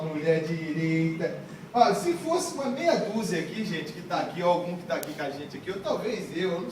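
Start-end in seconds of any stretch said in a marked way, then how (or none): 0.0-1.4 low, drawn-out imitation; mock echo. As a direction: stretch the phrase slowly in a low mocking voice. Mocking echo of the woman's phrase. (imitação debochada, arrastada e grave)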